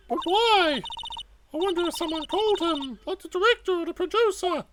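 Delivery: put-on high-pitched, earnest girl sidekick; eager young sidekick. Voices Maya to Nick.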